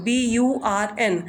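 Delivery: burn spelled slowly letter by letter; slow spelling. Shown as a demonstration. Teacher spelling burn for learners, slow. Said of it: "वो खींच कर".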